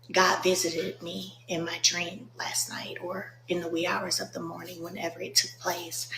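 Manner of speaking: a soft whisper telling of the dream; hushed confiding whisper. Real, not acted. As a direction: lean in and whisper it.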